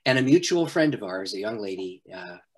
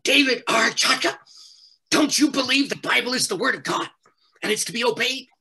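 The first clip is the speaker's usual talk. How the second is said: higher, louder, retelling an angry friend scolding him by full name; 11 semitones higher, 4 dB louder than usual. Retold or imitated. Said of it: "she got really mad".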